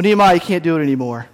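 ordinary talk, nothing marked

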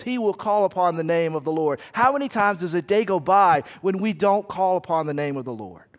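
normal talking voice

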